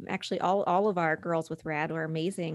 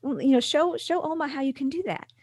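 reassuring mom; 8 semitones higher, 4 dB louder than usual. Retold or imitated. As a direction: gently coax her to read for Grandma. Mom reassuring her crying daughter.

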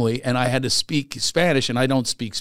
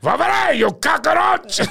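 much higher, loud, slow Cuban shout; Pacino Scarface impression; 5 dB louder, slower than usual. Comedian redoing his ad line as Scarface, shouting. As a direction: spit it like Tony Montana. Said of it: "Pacino in Scarface".

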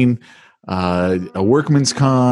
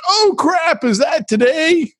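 much higher, slowed oh crap; dawning panic, pitched way up; mock startled realization; slower than usual. Husband forgetting anniversary, mock panicked. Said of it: "And I'm like".

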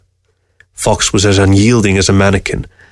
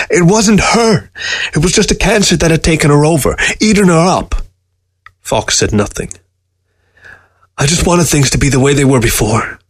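higher, pleading, near tears; anguished pleading; 7 semitones higher than usual. A narrator's reading. Newberg pleading with Fox about his dying wife, anguished. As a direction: let the grief push the voice up.

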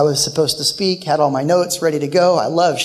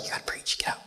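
whisper; 14 dB quieter, faster than usual. Retold or imitated. Wife nudging him to preach.

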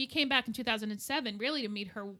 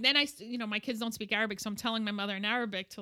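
normal talking voice

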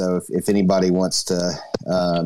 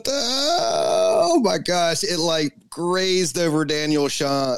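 high, slow astonished gasp; surprised; 7 semitones higher, much slower than usual. Real, not acted. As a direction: stretch out the amazement. Reacting to the wheel's result.

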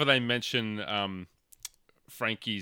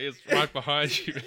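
laughing quote; 5 semitones higher than usual. Retold or imitated. Quoting reply, laughing.

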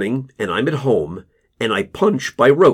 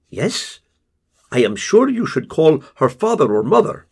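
Casper hedging to the father, higher and level; Casper's voice; 5 semitones higher than usual. Acted. Narrator voices Casper on phone.

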